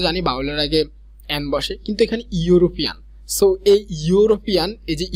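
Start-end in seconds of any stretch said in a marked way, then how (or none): none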